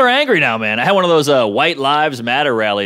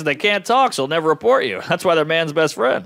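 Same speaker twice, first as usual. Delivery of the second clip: ordinary talk, nothing marked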